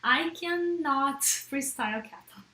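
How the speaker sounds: normal talking voice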